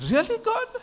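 very high, incredulous question; mock disbelief. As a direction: squeak it out as a baffled appeal. Samuel questions God once more.

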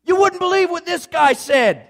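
high, loud, excited put-on accent; [name]. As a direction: crow it to passers-by in an exaggerated accent. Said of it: "got this incredible accent".

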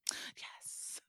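soft, voiceless cheer; whispered yes. Real, not acted. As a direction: whisper it in quiet triumph. Hushed yes after celebrating.

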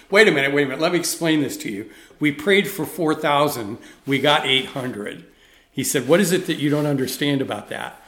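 heated argument. Retold or imitated. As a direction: hammer the point at him, retold loudly. Guy argues in heated meeting.

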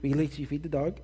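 imitated mother breaking into the call; lightly voiced nagging mom; mom on extension. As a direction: check on a chore over the phone.

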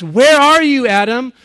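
loud, high anguished call; God crying out. Acted. God calling to hiding Adam and Eve, a loud high cry. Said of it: "God cries".

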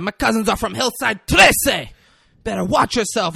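high, loud retold girl freaking out; hammed-up high-pitched outburst; mock freak-out. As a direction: act out an indignant, freaked-out threat. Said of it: "this girl starts freaking out".

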